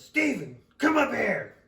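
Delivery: father calling his son to the stairs, loud and stern; dad calling up. Retold or imitated. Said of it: "he goes".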